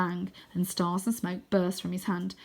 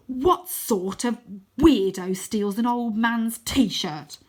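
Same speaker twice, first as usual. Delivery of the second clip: slow, loud disgust; horrified outburst; 3 semitones higher, 7 dB louder, much slower than usual. Acted. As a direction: spit out each word in horror.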